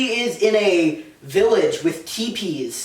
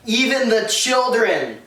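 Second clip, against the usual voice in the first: mild anguish; 3 semitones higher, slower than usual. Acted. Anakin confessing, dramatized.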